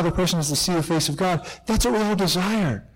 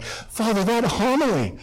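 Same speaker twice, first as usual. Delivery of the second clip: higher, lightly hammed-up admirer; gushing parishioner; 5 semitones higher than usual. Parishioner to priest after mass, gushing. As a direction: gush over it as if overwhelmed. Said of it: "they're like".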